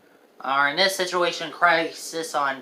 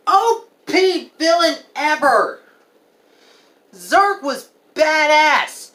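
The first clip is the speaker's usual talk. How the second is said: excited; 9 semitones higher, 4 dB louder, slower than usual. Real, not acted. Raving about favorite villain.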